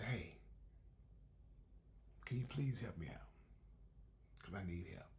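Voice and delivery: softly whispered plea for help; hushed, humble request to God. Retold or imitated. Voicing a quiet plea to God.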